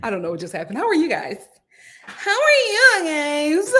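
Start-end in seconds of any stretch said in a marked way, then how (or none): 2.1-3.7 high, loud, stretched-out greeting; drawn-out greeting. Pretend. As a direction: draw it out slowly, pitched way up. Same greeting replayed drawn out.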